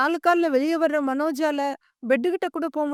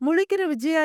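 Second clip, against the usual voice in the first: usual speaking voice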